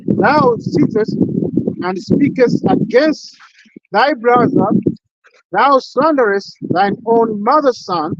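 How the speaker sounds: slow, loud solemn scripture reading; preaching scripture. Acted. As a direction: proclaim each line like a sermon from the pulpit. Reads Psalm aloud as preaching.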